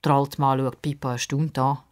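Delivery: usual speaking voice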